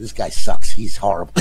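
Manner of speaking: loud, low mock insult behind his back; mock trash talk. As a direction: ham up the jab while he's gone. Mock insult while co-host away.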